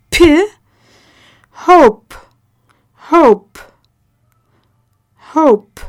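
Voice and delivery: a puff of air on the P in hope; P with a strong breath; aspirated P. Shown as a demonstration. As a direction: pop the P hard with breath. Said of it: "P with air coming out of the mouth".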